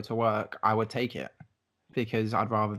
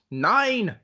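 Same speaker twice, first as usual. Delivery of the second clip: high shout; 9 semitones higher, 5 dB louder than usual. Acted. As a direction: shout "Nine!" high and loud.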